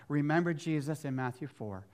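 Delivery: ordinary talk, nothing marked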